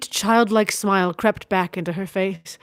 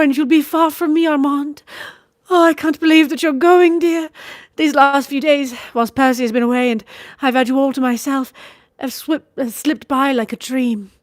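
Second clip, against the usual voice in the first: higher, louder lady's voice, fond and sorrowful; cling to every word like a loving sister; lady Marguerite; 6 semitones higher, 4 dB louder than usual. A narrator's reading. Sister begging her brother to stay, high and tender.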